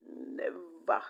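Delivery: "Never" hit loud with a falling end; emphatic never. Real, not acted. Insisting her mother never helped, loud and final. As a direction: slam down the word "Never".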